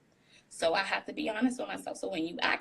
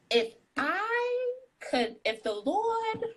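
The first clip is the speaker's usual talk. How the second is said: dreamy wish; 14 semitones higher, slower than usual. Real, not acted. Dreamy wish about singing.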